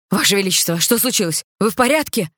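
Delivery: loud worried call from outside; through a door. Acted. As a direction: shout it from behind a door. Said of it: "раздался из-за двери голос слуги". (встревоженный крик из-за двери)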